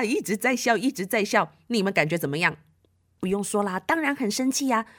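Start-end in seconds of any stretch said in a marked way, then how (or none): none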